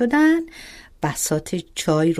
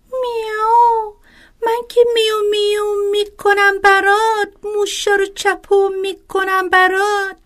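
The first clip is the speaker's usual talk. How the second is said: sulky cat; 12 semitones higher, 4 dB louder than usual. Acted. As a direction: meow it like a pouting kitten. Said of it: "با قهر گفت".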